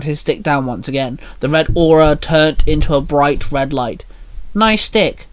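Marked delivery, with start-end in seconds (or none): 4.5-5.3 higher-pitched, lightly cheeky jab; smirking. A narrator's reading. Minnie teases Merlin about his staff, lightly smirking. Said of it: "Minnie smirked".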